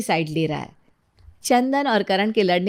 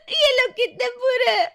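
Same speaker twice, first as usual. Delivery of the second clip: very high, wailing sobs; fake crying. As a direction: bawl theatrically as you complain. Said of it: "झूठ मूठ रोती हुई". (झूठ-मूठ रोते हुए)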